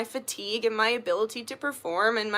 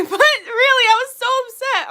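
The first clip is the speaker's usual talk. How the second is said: much higher and louder through a laugh; laughing upset; 13 semitones higher, 7 dB louder than usual. Real, not acted. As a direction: laugh it off while still annoyed.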